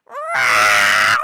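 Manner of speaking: very loud, harsh imitated yowl; screaming cat meow. Cat demanding her swirly be fetched, screamed meow. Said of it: "She is screaming".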